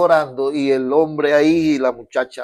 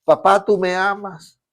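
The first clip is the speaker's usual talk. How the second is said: put-on higher pleading child's cry, "tú" stressed; a little boy calling to his mother in the night; mild child's plea to mom; 7 semitones higher, 3 dB louder than usual. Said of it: "Se levantaba gritando".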